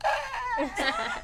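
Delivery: high panicked yelp, laughing. Retold or imitated. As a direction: yelp like a flustered singer. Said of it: "she just, like".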